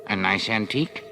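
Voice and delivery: appraising, approving little dealer. A narrator's reading. Man examines William's old fishing tackle, approvingly. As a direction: admire it like a trader. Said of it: "said the man approvingly".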